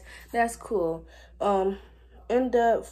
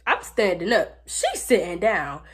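ordinary talk

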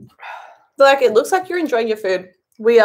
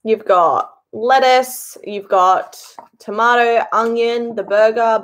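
normal talking voice

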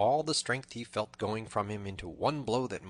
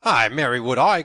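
forceful, raised-voice comeback; loud retort; 4 semitones higher, 15 dB louder than usual. A narrator's reading. Tanner answers his downed foe, loud retort. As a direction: throw it back at him loudly.

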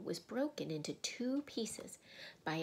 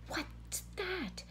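put-on high, alarmed girl's voice; startled girl; 12 semitones higher than usual. A narrator's reading. Lucy hears a great crack behind her, startled high voice.

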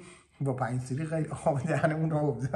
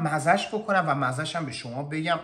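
ordinary delivery

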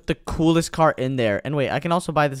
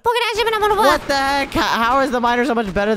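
exasperated disbelief; 10 semitones higher than usual. Real, not acted. Outgunned by the miner.

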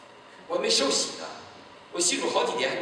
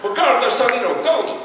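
higher, louder half-joking threat; mock threat; 12 semitones higher, 11 dB louder than usual. To the pastor at three in the morning, a loud mock threat. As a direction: bluster at the pastor.

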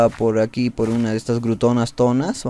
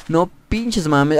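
ordinary talk, nothing marked

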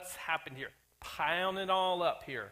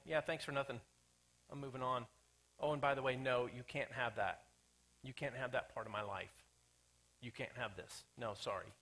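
low, soft, offhand refusal; a believer brushing God off, quiet and curt; dismissive brush-off; 6 semitones lower, 6 dB quieter than usual. Acted. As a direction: wave God off casually. Voicing ungrateful believer.

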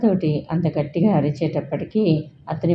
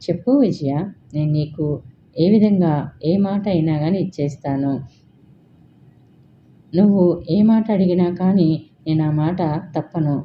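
ordinary delivery

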